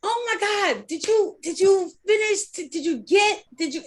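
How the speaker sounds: high, eager questions; excited friend. Retold or imitated. Playing the friend who asks eagerly about the dream, high and excited. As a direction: gush like a hopeful friend.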